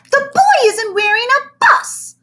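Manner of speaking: put-on high, loud tattling child; a bossy little girl telling on him; little girl protests. Girl protests to teacher, high and loud.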